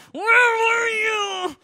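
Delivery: high, slow, stretched-out wail; mock exasperated shout; exasperated cry. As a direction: steam coming out, let it burst. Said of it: "steam is coming out of you".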